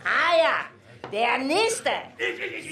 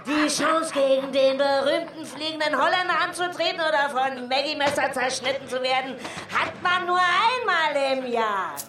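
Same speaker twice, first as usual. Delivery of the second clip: normal talking voice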